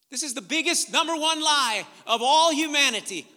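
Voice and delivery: preaching at full force. Real, not acted. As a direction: drive it home from the pulpit.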